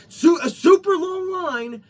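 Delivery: drawn-out angry yell; shouting. Real, not acted. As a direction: yell it out in outrage. Angry rant about beach line.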